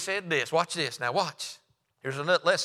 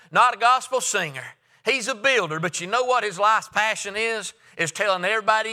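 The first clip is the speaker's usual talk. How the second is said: high, loud preaching; preaching at full force; 6 semitones higher, 4 dB louder than usual. Acted. Preacher telling the church about the builder, fervent. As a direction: ring it out over the pews.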